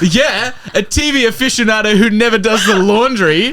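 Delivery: high, pointed jab; acted taunt. Friend to Jim about his laundry, high and taunting. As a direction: pitch it up and rub it in.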